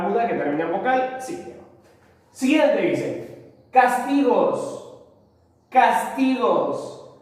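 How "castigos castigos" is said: castigos very slow, stretched on TI; cas-TI-gos, drawn out for dictation; stress on middle syllable. Shown as a demonstration. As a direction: sound out castigos, leaning on the TI. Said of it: "es una palabra grave".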